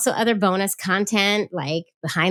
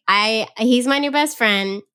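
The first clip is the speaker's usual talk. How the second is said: usual speaking voice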